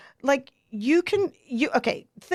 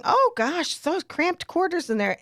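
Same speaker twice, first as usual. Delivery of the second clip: lightly voiced older lady, pitched up; mildly fussing, higher-pitched old lady; chatty older lady; 7 semitones higher than usual. Grandma complaining as she leaves the cramped toilet, lightly imitated chatter. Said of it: "she starts talking".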